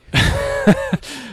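big cackling laugh; loud high laugh. Real, not acted. Teasing co-host about bad audio.

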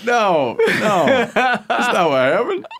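high, laughing protest that slows. Retold or imitated. Mo hearing the story and denying it, high laughing protest. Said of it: "He's gonna be like".

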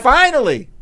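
excited. Retold or imitated. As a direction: cry out like the wait is over. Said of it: "Everyone was like".

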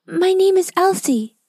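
put-on bright little girl answering bravely; young girl Elsie. A narrator's reading. Elsie answering the witch, high young girl voice. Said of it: "said Elsie".

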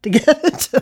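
breaking into a laugh at the end. Real, not acted. Historian amused by the quote she reads, starting to laugh. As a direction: let amusement overtake the last words of the reading. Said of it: "I'm sorry, I'm laughing there".

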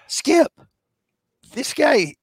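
high, slow, emphatic appeal; retold protest. Player defending a teammate to the manager, emphatic protest. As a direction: plead the case, word by word.

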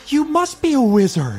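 high, gushing flattery; awed salesman. Acted. Salesman buttering up the wizard, high and gushing. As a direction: lay the flattery on thick.

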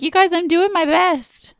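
high, pleading little protest; mock plea. Mom to her kids, mock pleading. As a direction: plead it lightly, pitched well up.

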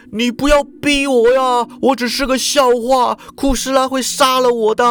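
lightly voiced cowering plea; frightened pleading. A narrator's reading. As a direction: beg her to stop. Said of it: "他说".

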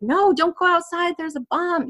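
high, urgent mock plea; retold panicked warning. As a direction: re-enact frantically warning a stranger off. Re-enacts her warning to the businessman.

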